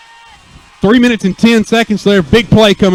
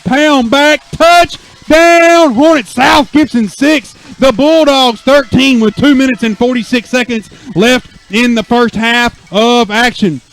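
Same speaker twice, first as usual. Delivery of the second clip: excited, higher announcer voice; touchdown call; 5 semitones higher than usual. Real, not acted. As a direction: keep the thrill up after the score. Hyped touchdown recap.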